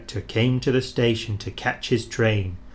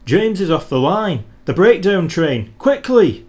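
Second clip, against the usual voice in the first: higher, louder urgent shouts; a signalman raising the alarm; shouting; 6 semitones higher, 7 dB louder than usual. A narrator's reading. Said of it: "he shouted".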